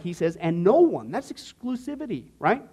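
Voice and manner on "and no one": "no one" hit louder and firmly; emphatic NO ONE. Acted. Quoting Jesus on exclusivity, emphatic stress on NO ONE. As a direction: drive home "no one" with force. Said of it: "he's very emphatic".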